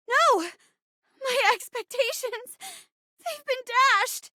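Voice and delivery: high-pitched hammed-up despair; melodramatic dismay. Friend mock-mourning dashed expectations, melodramatic dismay. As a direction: cry out no, then lament in a high voice.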